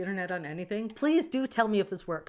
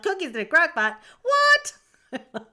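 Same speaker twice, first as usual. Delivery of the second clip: squeaky, much higher, louder, incredulous; stunned disbelief; 11 semitones higher, 12 dB louder, slower than usual. Retold or imitated. To viewers, replaying her shock at crock pot cookies, squealing disbelief. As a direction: shriek of mock shock, way up high. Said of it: "I'm like".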